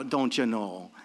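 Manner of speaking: low, softly tossed-off Midwest accent. Shown as a demonstration. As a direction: lightly put on a Midwest twang. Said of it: "Somebody from the Midwest".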